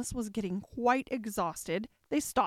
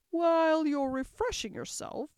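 queen voice; 6 semitones higher than usual. A narrator's reading.

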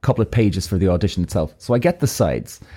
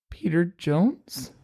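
unsure and drawn out, quiet and slow; nervous hesitant guess; 6 semitones higher, 6 dB quieter, much slower than usual. Retold or imitated. Caught out on the phone, he mumbles the agent's name slowly and nervously. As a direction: barely dare to say the agent's name.

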